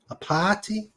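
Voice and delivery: party with a New England accent, the r dropped: pahty. Shown as a demonstration. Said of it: "New England accent coming out?".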